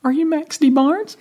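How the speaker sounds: high, slow question rising at the end; dawning realization. Retold or imitated. Storyteller realizing who the stranger is, retold astonished question.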